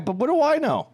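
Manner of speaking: mock Jamaican accent. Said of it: "when you went".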